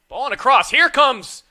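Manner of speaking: loud, rising, excited call; excited shout. Real, not acted. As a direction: call the attack at full volume. Goal chance in live commentary.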